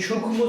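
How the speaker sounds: সূক্ষ্ম said as "shukkhmo", sounding the m; mispronounced m. Shown as a demonstration. Teacher warning students of a wrong pronunciation, m sounded. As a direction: put an audible m after the kh in সূক্ষ্ম. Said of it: "সেটা ভুল হবে".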